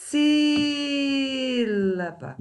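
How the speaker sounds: "Sílaba" with a very long, high "Sí"; stressed syllable drawn out. Shown as a demonstration. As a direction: hold the first syllable of "Sílaba" high, then drop off. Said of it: "antepenúltima".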